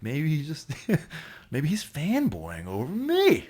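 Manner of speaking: high, drawn-out joking self-flattery; mock boast. Actor jokes that the writer admires him, mock boast.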